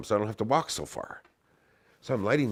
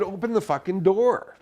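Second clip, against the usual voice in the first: higher, louder angry yell; yelling to open; 11 semitones higher, 4 dB louder than usual. Retold or imitated. Banging on the car window, yelling at friends inside. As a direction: shout through the window, furious.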